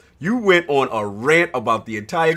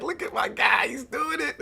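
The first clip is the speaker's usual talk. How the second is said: excited; 12 semitones higher, 3 dB quieter than usual. Retold or imitated. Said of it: "I was like".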